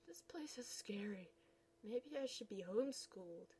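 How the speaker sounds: hushed, voiceless and frightened; scared whisper. Acted. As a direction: whisper it like you fear being heard. Scared whisper in new school.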